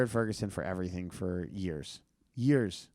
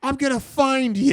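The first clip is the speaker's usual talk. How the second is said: much higher, louder menacing shriek; shrill threat; 9 dB louder than usual. Retold or imitated. Danny threatens to track the comedian down, high and loud. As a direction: threaten him in a high rage. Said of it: "And he went".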